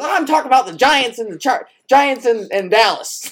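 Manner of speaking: put-on an old coach cheerfully on the wrong game; confused Mike Ditka. Caught off topic, Mike imitated with a high befuddled voice. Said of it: "He goes".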